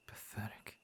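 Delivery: a quiet, withering sneer; soft whispered contempt; contemptuous whisper. Acted. Contempt for the villains.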